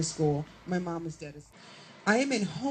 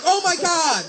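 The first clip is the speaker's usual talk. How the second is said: high, loud mock alarm; horrified retold cry; 10 semitones higher, 8 dB louder than usual. Girl watching mom choke her teacher, acted horror. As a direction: cry out like an appalled kid.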